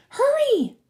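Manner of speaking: put-on high, loud girl's cry; sharp high urging; urgent order. A narrator's reading. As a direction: hurry someone into action. Annabeth urges Percy on.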